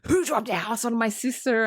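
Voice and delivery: mock witch's angry demand; hammed-up villain accusing the room; pissed-off witch. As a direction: put on an outraged witch voice. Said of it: "the Wicked Witch is fucking pissed".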